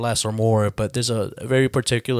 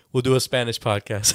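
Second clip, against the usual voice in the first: ordinary talk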